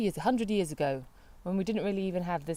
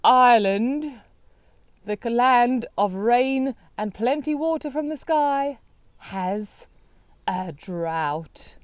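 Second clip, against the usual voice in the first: loud, slow dramatic headline; mock news announcement; 5 semitones higher, 8 dB louder, much slower than usual. Telling co-host what else is in the news, mock-grand proclamation.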